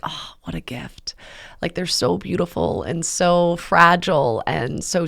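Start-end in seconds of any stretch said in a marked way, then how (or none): 0.0-1.1 soft whispered awe; awed whisper. Retold or imitated. Holding a tiny newborn in her arms, hushed whisper. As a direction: breathe it out over a sleeping newborn.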